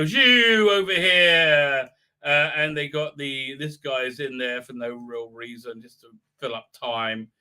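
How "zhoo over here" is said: loud, drawn-out call across the yard; mock guard call. Guards hailing the bikers from their post, mock call. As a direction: sing out a lazy louder hail.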